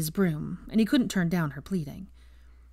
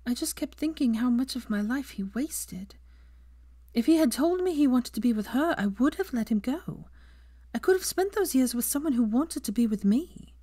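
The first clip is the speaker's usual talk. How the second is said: put-on higher, whispery, troubled young woman; 7 semitones higher than usual. A narrator's reading. Hermione voiced in story.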